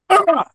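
very high "ah!"; sudden high yelp of frustration; exasperated cry. Retold or imitated. Cart jams at the escalator.

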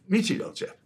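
high mock-English accent; imitated Englishman's high voice. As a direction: play the chummy English chap, pitched high. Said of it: "in a sort of English accent".

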